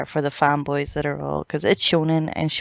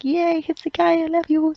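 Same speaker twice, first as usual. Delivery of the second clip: much higher, gushing mock squeal; fangirl squeal; 12 semitones higher than usual. Cheering on a favourite captain, much higher fangirl squeal. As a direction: squeal like a swooning fan. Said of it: "And it's like".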